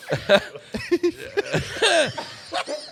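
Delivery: high breathless laughter; long helpless laugh. Real, not acted. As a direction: crack up completely. Cracking up hard.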